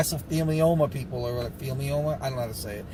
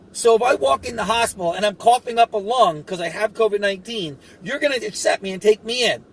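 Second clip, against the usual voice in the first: exasperated retort; 6 semitones higher than usual. Retold or imitated. Retold argument with hospital staff.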